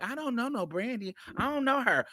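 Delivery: telling the challenger how he did not deny it: mild, meek and soft; meek denial. Shown as a demonstration. Not the way he said it, small and pleading. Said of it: "So I'm not saying it like".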